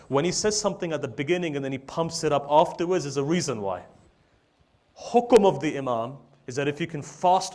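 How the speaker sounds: high, loud, urgent sermon delivery; full-force preaching. Real, not acted. Preacher urging the congregation to heed the Imam, full-force preaching. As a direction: drive the point home at the top of the voice.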